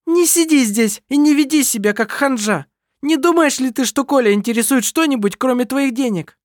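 high, loud furious attack; loud high spiteful rage; sudden fury. A narrator's reading. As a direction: lash out at Anna-Maria. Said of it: "В припадке внезапной ярости".

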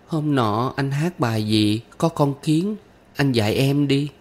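put-on high child voice asking for a song; small girl asking to be taught; pleading little girl. A narrator's reading.

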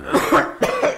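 loud forced hacking cough; loud fake coughing fit. Prisoner coughing loudly to drown out the inspector.

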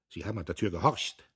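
ordinary delivery, nothing marked

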